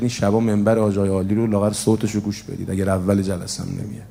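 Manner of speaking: normal talking voice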